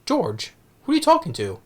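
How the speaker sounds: put-on high, puzzled old-lady voice; high elderly wife voice calling out; Joan Plowright. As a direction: call out to your husband in a high voice. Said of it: "Joan Plowright is like".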